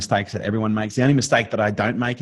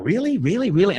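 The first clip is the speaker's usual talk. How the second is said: much higher, eager questioning; thrilled child; 10 semitones higher than usual. Retold or imitated. Son reacting to dad's praise, high and eager. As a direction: imitate a kid lapping up praise. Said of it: "He's like".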